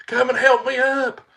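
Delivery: high, loud yell to someone far off; loud, high holler from the ground; retold scream for help. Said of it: "screaming at her".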